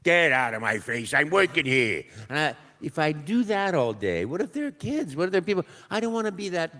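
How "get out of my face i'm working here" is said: slow, gruff-edged Brooklyn Italian tough guy; tough Brooklyn plumber. Pretend. Auditioning actor tries a rude Brooklyn plumber, tough Brooklyn accent. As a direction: brush someone off in a Brooklyn Italian voice. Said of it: "Italian plumber from Brooklyn".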